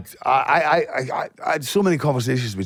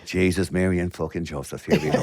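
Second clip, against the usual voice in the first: lightly put-on Irish accent, lower, with a laugh; 6 semitones lower, 4 dB quieter than usual. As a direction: play the jovial Irishman, lower. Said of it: "We're going to do the rest of the podcast just like this".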